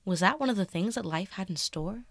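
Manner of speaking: ordinary delivery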